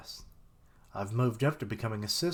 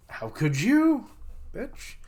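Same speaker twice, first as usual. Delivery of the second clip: betrayed cry; 10 semitones higher, 6 dB louder, slower than usual. Acted. Betrayed trainee cries out.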